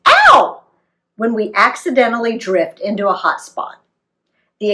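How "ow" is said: sharp high yelp of pain; startled high ow, loud; yelled ow. Retold or imitated. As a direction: yelp as the hot water hits. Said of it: "someone yells".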